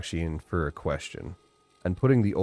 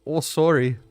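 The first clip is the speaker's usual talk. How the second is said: higher, louder Canadian "Oh, sorry" with a falling sorry; bright, hammed-up Canadian "sorry"; 8 semitones higher, 5 dB louder than usual. Shown as a demonstration. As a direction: sing out a friendly Canadian apology. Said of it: "That's another one".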